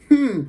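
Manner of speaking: very high, loud pouting hmm; childish pouty hmm. Retold or imitated. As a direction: whine it like a sulking little kid.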